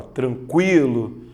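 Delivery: "tranquilo" slowly drawn out as tran-KWII-lo; slow, exaggerated KWI in "tranquilo"; pronounced U. Shown as a demonstration. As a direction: stretch "tranquilo", sounding the U after Q. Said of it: "a letra U é pronunciada".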